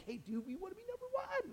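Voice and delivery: lightly mock the brothers asking for the top spot; mock dude. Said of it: "talk to him and be like".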